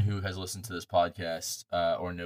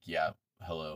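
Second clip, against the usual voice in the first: bored, jaded answering voice; flat, fed-up hello; jaded phone greeting; 3 semitones lower, 7 dB quieter than usual. Retold or imitated. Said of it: "I started becoming so jaded".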